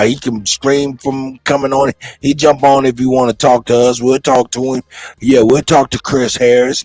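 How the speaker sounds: usual speaking voice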